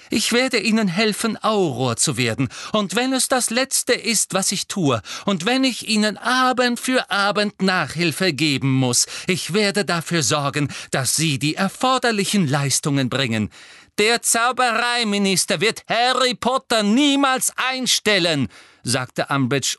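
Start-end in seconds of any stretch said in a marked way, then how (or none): 0.1-13.5 high, forceful declaration; fierce, emphatic promise; ringing vow. A narrator's reading. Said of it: "sagte sie glockenhell". 14.0-18.5 high, loud furious outburst; angry shout of refusal; swelling with anger. A narrator's reading. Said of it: "ihre Stimme schwoll an vor Zorn".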